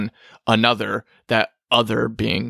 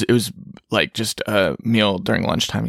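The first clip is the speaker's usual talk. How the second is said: normal talking voice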